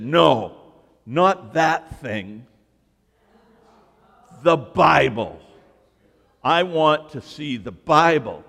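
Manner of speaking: a light hint of a scolding old man; gruff old man. Old churchgoer scolding the pastor, lightly voiced gruff voice. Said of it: "He said".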